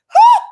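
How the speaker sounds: very high, loud "whew!"; swooning whoop. Acted. Reacting to his looks, a swooning high whoop. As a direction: fan yourself with a shrieking whoop. Swooning over handsome man.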